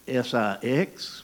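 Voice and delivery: put-on slow southern-accented spelling of six, S I X, each letter drawn out; southern drawl spelling. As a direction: spell it like a Kentucky schoolboy. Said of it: "I go".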